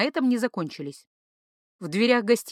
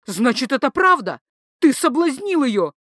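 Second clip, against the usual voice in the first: loud, higher, accusing husband; jealous accusation; 5 semitones higher, 5 dB louder than usual. A narrator's reading. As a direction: hurl the accusation. (ревнивое обвинение)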